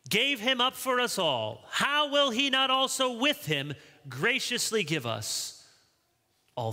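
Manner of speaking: high, impassioned preaching voice; fervent scripture reading. Real, not acted. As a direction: proclaim the verse to the congregation. Reads verse with full force.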